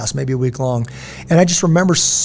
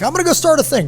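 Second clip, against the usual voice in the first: high-pitched gung-ho mimicry; mock eagerness; 12 semitones higher, faster than usual. Mimicking someone keen to start a thing, mock eager.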